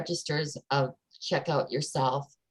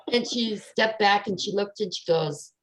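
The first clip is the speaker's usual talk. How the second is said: ordinary talk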